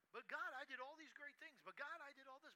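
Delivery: high, loud whining self-pity; whiny woe is me. Retold or imitated. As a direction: whine like a sulking prophet. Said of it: "woe is me" session".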